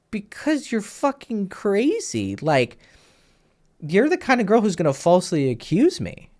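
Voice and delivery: high-pitched, exasperated retort; retold exasperated accusation. Storyteller replaying his retort to the crazy girl, exasperated and high. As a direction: replay telling someone off, pitched well up.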